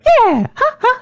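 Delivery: high, loud cartoon Mario cheer. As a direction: squeal it out like a cartoon plumber. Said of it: "Mario impressions".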